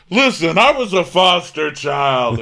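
loud, high, slow mock protest; Marlo. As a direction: plead your case with hammed-up force. Mimics Marlo arguing in self-defence.

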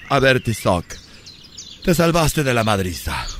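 low, slow and gruff. Acted. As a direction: growl it low and slow like a tough guy. Voicing stern man in parody.

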